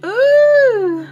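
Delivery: long high, loud "ooh". Real, not acted. Vlogger breaking off to look at something, high drawn-out ooh. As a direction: hold one high loud hoot. Reacting to something, sung-out hoot.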